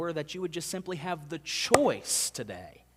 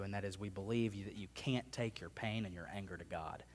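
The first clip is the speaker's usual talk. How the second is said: ordinary talk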